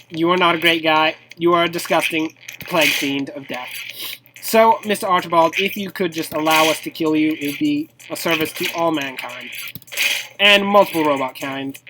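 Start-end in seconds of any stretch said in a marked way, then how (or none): none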